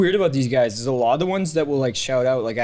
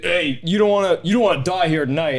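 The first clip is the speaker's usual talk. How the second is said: lightly voiced belligerent old drunk, pitched up; imitating drunk old heckler; 5 semitones higher, 4 dB louder than usual. Comic retelling an old drunk's threat, mock-belligerent. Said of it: "this one really fucking old dude".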